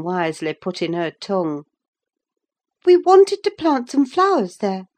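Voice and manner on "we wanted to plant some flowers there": put-on high, loud young woman's outcry; a girl calling out her excuse; crying out. A narrator's reading. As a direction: pipe up high and loud in protest. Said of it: "she cried".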